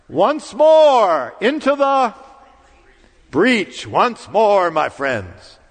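soaring, slow declaimed rallying cry from horseback, pitched high and loud; rally the troops to charge the walls; a king's stirring call to arms; Henry's battle cry. Retold or imitated. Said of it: "And what does he say?".

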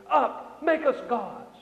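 acted call to a leader; crowd's demand. Preacher voices Israelites demanding gods.

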